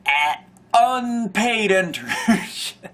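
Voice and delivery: high, slow, holding back laughter; stifled laughter. Real, not acted. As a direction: push it out through giggles. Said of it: "can't say that without laughing".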